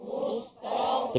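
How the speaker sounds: whisper practice. Pretend. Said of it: "Tepi lidah kan?".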